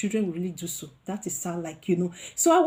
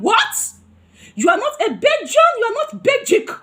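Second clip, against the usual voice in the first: high, loud, indignant questions; angry disbelief; 13 semitones higher, 8 dB louder than usual. Retold or imitated. Confronting the woman on the bus, loud angry disbelief. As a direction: hurl the questions at her in outrage. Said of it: "I got angry".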